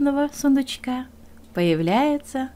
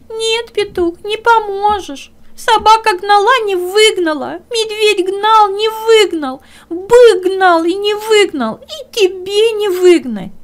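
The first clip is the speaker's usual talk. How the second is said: high, louder crying hare; tearful hare; 10 semitones higher, 8 dB louder than usual. Acted. Hare tells the bear he can't help, tearful and high. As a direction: sob out that nobody can help.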